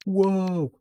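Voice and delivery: surprised whoa. Real, not acted. Sudden reaction mid-chat.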